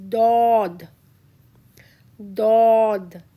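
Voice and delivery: Dod heavy and loud, with a dark O. Shown as a demonstration. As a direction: push a strong, heavy Dod. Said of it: "like D but little heavy".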